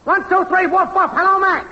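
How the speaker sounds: high-pitched, singsong mic-test chant; woofing mic-test yell. Acted. Technician testing if the show is on air, high woofing yell. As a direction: chant it high, evenly, like barked test words. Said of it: "woof woofing and yelling".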